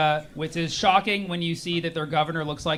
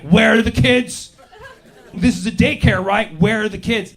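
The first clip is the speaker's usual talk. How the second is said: menacing demand; 3 semitones higher, 6 dB louder than usual. Retold or imitated. Mock masked men interrogating.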